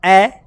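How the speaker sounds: ए said as a short clipped a, loud; short loud phonic a; short phonic a sound. Shown as a demonstration. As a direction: stamp out ए as a bare sound. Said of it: "ए का sound है ए".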